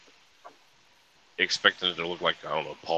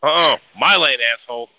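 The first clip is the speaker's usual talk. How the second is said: shouted mock road rage; angry; 7 semitones higher, 12 dB louder than usual. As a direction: bark it like an angry driver. Road rage quip, mock driver.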